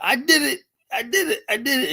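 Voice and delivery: high, triumphant crowing; gleeful cheer. Retold or imitated. Big girl celebrating the grab, high and gleeful. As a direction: crow it out like a winner.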